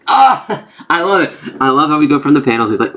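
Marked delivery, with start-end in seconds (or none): 0.1-0.7 short, high "ah!" of shock; mock shocked cry. Reader mimes reaction to the confession, high shocked cry. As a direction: cry out in comic outrage.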